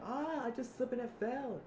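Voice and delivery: very high, loud mock wail; falling cry. Zip slipping over while fleeing, high loud wail. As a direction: cry out as if just tumbled.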